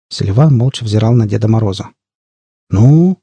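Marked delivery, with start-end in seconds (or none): none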